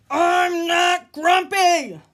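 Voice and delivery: loud, slow, furious yell; angry shout. Acted. Jim shouting at everyone, loud furious yell. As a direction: bellow it out, pounding the chest. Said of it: "shouted Jim".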